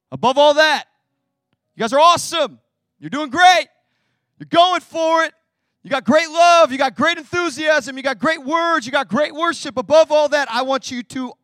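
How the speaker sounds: loud, high, fervent praise; preaching at full force. Acted. Preacher voicing Paul cheering the church loudly. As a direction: shout the encouragement to the church.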